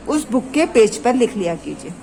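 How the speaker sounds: usual speaking voice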